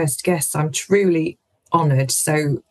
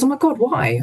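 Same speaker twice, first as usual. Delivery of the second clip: imitating her gasp of surprise, pitched much higher; stunned disbelief; 9 semitones higher than usual. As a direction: squeak it out like she can't believe it. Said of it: "She goes".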